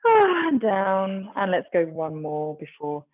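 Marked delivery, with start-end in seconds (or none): 0.1-1.3 long exhale sigh. Acted. Exhale cue in class.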